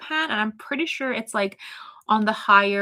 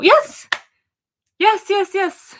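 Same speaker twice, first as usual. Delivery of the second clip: squealing yes, yes, much higher and louder; delighted, leaping up in pitch; excited yes; 10 semitones higher, 9 dB louder, slower than usual. Real, not acted. Streamer thrilled a viewer named her favourite noodles, excited.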